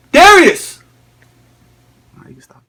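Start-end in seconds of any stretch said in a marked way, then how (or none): 0.1-0.8 high, full-voiced summoning shout; calling out. Father calling his son down once more, shouted call. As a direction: holler the name to someone out of sight.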